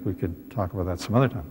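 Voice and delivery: ordinary delivery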